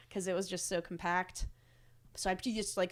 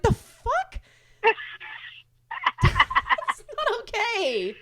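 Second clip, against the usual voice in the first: very high, loud mock outrage; hammed-up horrified protest; retold shock; 9 dB louder, much slower than usual. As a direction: squeal it in appalled disbelief, drawing out the end. Tourist appalled by French guide.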